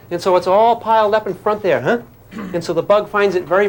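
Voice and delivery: ordinary delivery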